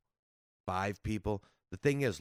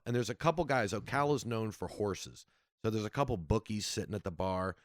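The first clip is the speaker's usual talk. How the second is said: ordinary delivery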